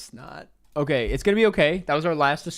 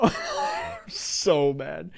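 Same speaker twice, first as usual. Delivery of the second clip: very slow, laughed through; laughing mock anger; 3 semitones higher, much slower than usual. Real, not acted. Streamer laughing at his own loss while calling himself mad. I'm so mad right now.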